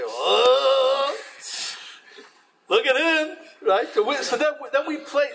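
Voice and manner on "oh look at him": scornful. Retold or imitated. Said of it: "and we say".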